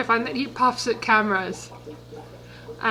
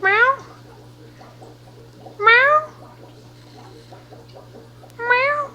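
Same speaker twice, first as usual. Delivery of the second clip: high playful meows; cat meows, high and playful; mock meow; 10 semitones higher, much slower than usual. Meowing at the cat.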